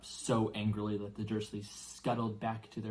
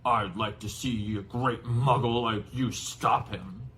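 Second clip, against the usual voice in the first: slow, loud, mocking challenge; scornful giant; 6 dB louder, slower than usual. Giant taunts the uncle, slow loud scorn. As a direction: dare him to try, slowly.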